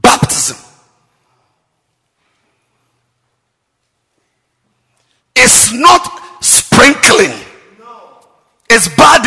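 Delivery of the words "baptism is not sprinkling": slow emphatic declaration. Real, not acted.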